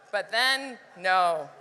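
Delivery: ordinary delivery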